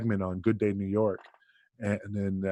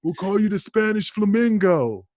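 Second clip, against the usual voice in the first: much higher mock trainer, proud pitch; trainer's better idea; 8 semitones higher, 5 dB louder than usual. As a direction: announce it like a stroke of genius. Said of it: "and Johnny's like".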